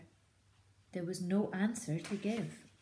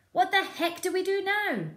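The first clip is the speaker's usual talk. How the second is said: much higher, loud exasperated cry; exasperated farmer; 13 semitones higher, 8 dB louder than usual. Acted. Boggis asking the others, high exasperated cry. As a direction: throw up your hands, high and loud. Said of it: "he said".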